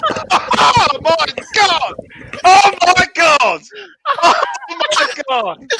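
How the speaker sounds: high, slow, laughing shout; ecstatic goal shout. Real, not acted. Goal celebration.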